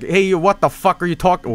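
offended stranger. Retold or imitated. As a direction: act out an affronted man confronting someone. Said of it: "got really offended because he was Polish".

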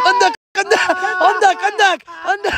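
excited call. Real, not acted. Shouting a warning, laughing.

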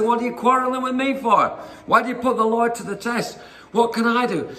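high-pitched, acted exasperation; exasperated protest. Moses answers the quarrelling crowd.

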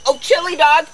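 high loud call after the dog. Real, not acted. Owner calling her runaway dog back, high and loud. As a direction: shout him back as he runs.

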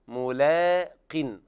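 ملاق with a thin light l, very slow; light lam. Shown as a demonstration. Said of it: "لابد من ترقيق اللام". (ترقيق اللام في ملاق)

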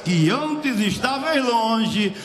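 loud, drawn-out revival cadence; impassioned preaching. Real, not acted. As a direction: proclaim it at full force, stretching key words. Preaching at full force.

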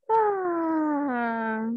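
drawn-out sleepy yawn; mock yawn. Shown as a demonstration. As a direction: let out a big yawn. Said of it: "Cuando tengo sueño".

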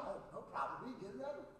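soft, whispered easygoing reply; carefree whisper. Retold or imitated. Preacher voicing his cheerful friend in a soft whisper. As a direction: shrug it off gently, nothing bothers you.

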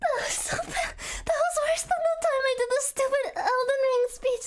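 usual speaking voice

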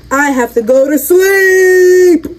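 slow, wailing protest shout; outraged cry. Acted. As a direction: cry it out in mock despair. Outraged at being sent to bed.

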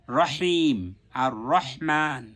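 slow রহিম, রহমান with strong pharyngeal h; careful Arabic recitation of রহিম and রহমান; mid-throat h. Shown as a demonstration. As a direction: stretch the vowels and squeeze each h from the throat. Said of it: "এই শব্দগুলো এই হা দিয়ে উচ্চারণ আছে".

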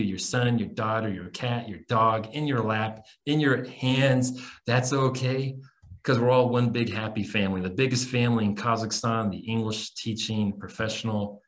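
ordinary talk, nothing marked

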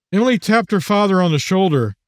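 ordinary delivery

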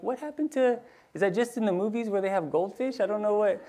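high, baffled disbelief; incredulous. Retold or imitated. Dad reacting to pet store lady's price, high and baffled. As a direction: sound thrown by the price.

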